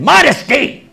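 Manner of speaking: high, loud, offended cry of modesty; angry woman defending her dress, loud indignant cry; angry retort. Retold or imitated. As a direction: fire back the word in anger. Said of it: "And now she's angry".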